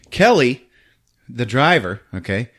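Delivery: usual speaking voice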